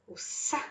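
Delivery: "sa" softly breathed, S hiss unvoiced; whispered sa. Shown as a demonstration. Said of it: "essa sonoridade do sapo". ("o sa" sussurrado)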